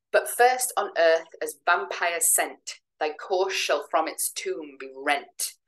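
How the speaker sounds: slow, every second word stressed, "sent" and "rent" leaned on; iambic stress. Shown as a demonstration. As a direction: beat out the iambic tetrameter slowly. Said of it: "the emphasis falls on every second word".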